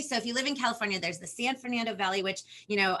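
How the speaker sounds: normal talking voice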